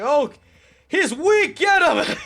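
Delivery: high, loud gleeful taunt; mock ghost taunt. Imitating ghosts spotting a weak player, high and loud. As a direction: voice the ghosts ganging up. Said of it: "looked at me like".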